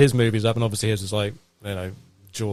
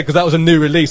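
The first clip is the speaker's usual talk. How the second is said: ordinary talk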